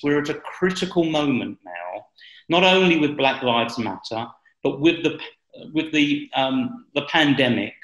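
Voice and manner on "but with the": usual speaking voice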